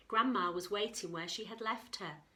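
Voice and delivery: usual speaking voice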